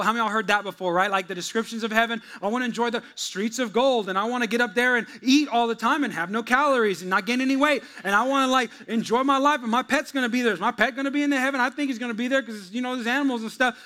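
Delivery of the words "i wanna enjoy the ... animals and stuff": naive believer. Retold or imitated. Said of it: "we can kinda be like".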